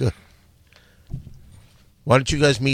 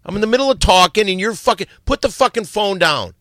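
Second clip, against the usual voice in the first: sharp, raised scold; angry scolding; 7 semitones higher than usual. Real, not acted. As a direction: snap at him to stop.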